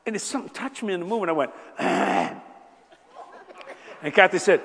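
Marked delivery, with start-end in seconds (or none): 1.8-2.4 low breathy catch of emotion; choked gasp. Retold or imitated. Husband moved by a movie, choked little gasp. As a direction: let a sob slip out.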